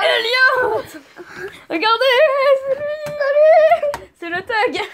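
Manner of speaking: high, loud hype yell; excited shout. Real, not acted. Hyping brother's entrance.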